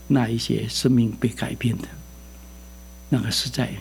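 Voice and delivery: sincere hushed aside. Real, not acted. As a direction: drop to a hush. Hushed reflection in sermon.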